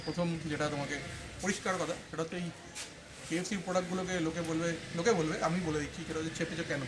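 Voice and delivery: muffled by cloth mask; through a face mask. Real, not acted. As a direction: mask covering mouth and nose.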